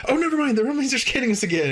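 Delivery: very high, rushed mock alarm; urgent interruption. Commando interrupting yet again as farce builds, very high mock urgency. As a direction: squeal it out fast, hammed up. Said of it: "interrupting her and saying".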